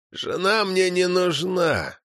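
put-on slow creaky old man voice. A narrator's reading. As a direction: creak it out like an ancient elder. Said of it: "проскрипел старик".

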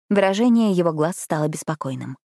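usual speaking voice

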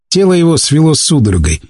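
ordinary delivery, nothing marked

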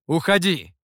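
loud, firm order to leave; demanding. A narrator's reading. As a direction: demand it with one forceful push. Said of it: "потребовал". (требовательно, громко)

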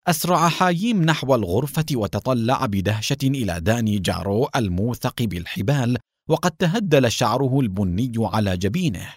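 ordinary delivery

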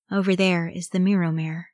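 ordinary delivery, nothing marked

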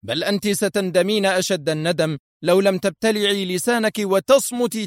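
angry sternness. A narrator's reading. Husband silences his wife and vows to go, angrily stern. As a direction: throw the threat back angrily. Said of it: "قال في صرامة غاضبة".

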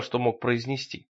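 usual speaking voice

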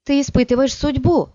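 high, sharp reproach; alarmed warning. A narrator's reading. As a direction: scold him, alarmed. Дхури warns Биджас.

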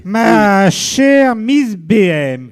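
drawn-out, stately address; slow grand address. Shown as a demonstration. Host to Miss B M, slowly and grandly. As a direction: stretch every word like a pompous host. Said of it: "Ça sera coupé".

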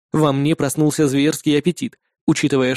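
plain reading voice

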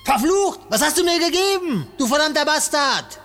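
furious high-pitched yelling; angry shouting. Acted. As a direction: shout curses in rage. Captive curses the professor.

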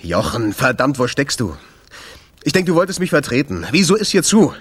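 frustrated message; annoyed voicemail. Acted. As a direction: vent into the phone at the absent friend. Angry voicemail to Jochen.

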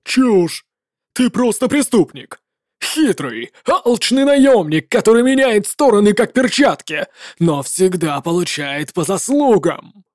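indignant commander shouting down a rival; a general who refuses to share the glory; furious legate. Acted. Legate insults the mercenary.

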